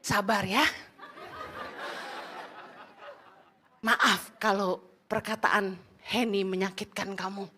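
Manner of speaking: low, soft, soothing apology; smooth things over gently; friendly to congregation. Retold or imitated. Husband calming the offended member, low and soft. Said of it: "langsung ramah".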